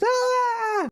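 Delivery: terrified heroine scream; mock scream. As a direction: shriek like a horror heroine. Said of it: "she's screaming this entire time".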